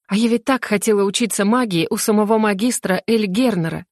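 ordinary delivery

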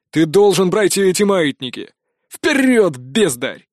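barking drill orders; loud sergeant yelling at recruits. A narrator's reading. Instructor shouting drill orders.

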